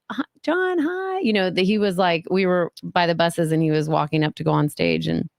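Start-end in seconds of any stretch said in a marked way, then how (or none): 0.1-1.2 very high, soft, breathless greeting; starstruck squeaky hello. Retold or imitated. Fan squeaking hello to her TV idol, very high. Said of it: "so I am like".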